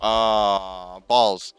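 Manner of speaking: loud, annoyed ah, balls; gamer's loud swear after a mistake; frustrated outburst. Real, not acted. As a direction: curse at the game.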